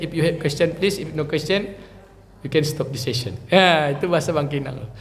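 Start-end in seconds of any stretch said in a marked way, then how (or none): none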